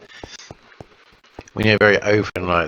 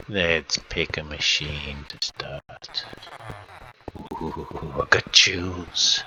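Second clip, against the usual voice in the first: ordinary talk, nothing marked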